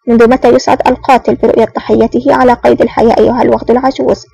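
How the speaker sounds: ordinary delivery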